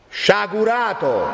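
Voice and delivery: high, slow ringing denunciation; full, sonorous cry. A narrator's reading. Friar rebukes Renzo with his old full voice, crying out. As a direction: draw the word out and let it ring. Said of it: "ripresa tutta l'antica pienezza e sonorità".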